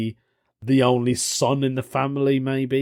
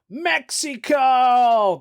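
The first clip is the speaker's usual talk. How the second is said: drawn-out, much higher shouted reveal; big mock shout; 11 semitones higher, 4 dB louder, much slower than usual. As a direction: belt the country name like a game show host. Grand reveal of story's origin.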